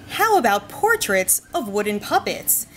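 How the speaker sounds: normal talking voice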